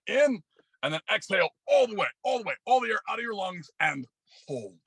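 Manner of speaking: rising, urgent breath calls; building coach intensity. Acted. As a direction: push them harder each breath.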